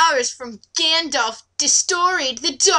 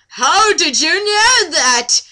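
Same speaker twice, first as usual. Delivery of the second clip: mock amazement; 4 semitones higher, 6 dB louder, slower than usual. [name] asks amazed.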